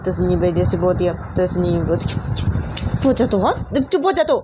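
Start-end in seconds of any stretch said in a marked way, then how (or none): 2.3-4.4 higher-pitched scolding hush; angry silencing. Acted. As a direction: cut the daughter off, pitch climbing on the repeat. Mother silences her accusing daughter. (बेटी को डाँटकर चुप कराती ऊँची आवाज़)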